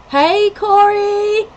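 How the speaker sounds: high, drawn-out happy hello; long, rising sing-out greeting; excited greeting. Real, not acted. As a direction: call out to a friend who just arrived. Greeting a viewer arriving in chat.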